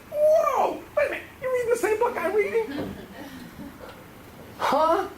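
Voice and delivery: high laughing whoa of disbelief; amazed surprise. Retold or imitated. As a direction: squeal with delighted shock, laughing. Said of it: "I was like".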